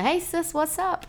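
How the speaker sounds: high, casual Kiwi street greeting; lifted, chummy bro accent; Kiwi bro lingo. Shown as a demonstration. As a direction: greet her like a New Zealand bro. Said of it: "everyone's a bro, a cuz, or a sis".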